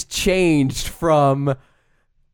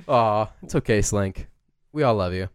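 soft, low comfort; soothing; 8 semitones lower, 3 dB quieter than usual. Acted. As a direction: gently reassure a sad pet. Comforting the dog voice.

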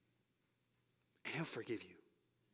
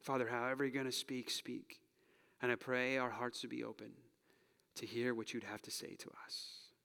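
earnest closing prayer. Real, not acted. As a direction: pray it sincerely for the room. Pastor leads closing prayer.

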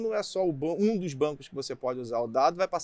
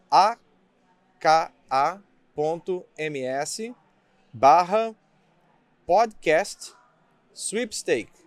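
slow, separated words for an address; slow dictation; 4 dB louder, much slower than usual. Shown as a demonstration. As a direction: dictate it slowly. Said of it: "Vou soletrar".

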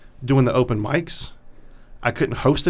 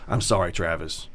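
low, soft imitation of an apology; quietly mimicked apology, pitched way down; retold apology; 9 semitones lower, 7 dB quieter than usual. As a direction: imitate a subdued sorry, dropped low. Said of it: "he would apologize to me".

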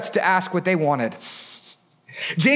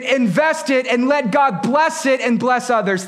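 high, driving sermon delivery; preaching at full force; 5 semitones higher than usual. Real, not acted. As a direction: press every phrase home with rising intensity.

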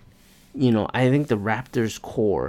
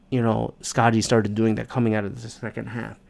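ordinary talk, nothing marked

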